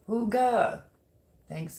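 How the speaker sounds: ordinary talk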